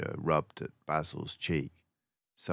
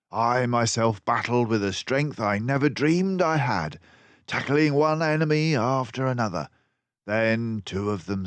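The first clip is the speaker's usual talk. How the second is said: rising, louder storytelling as the fight breaks out; breathless battle narration, pitched up and louder; excited narration; 6 semitones higher, 5 dB louder than usual. A narrator's reading. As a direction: tell the brawl with building urgency. Narrating a fierce fight scene.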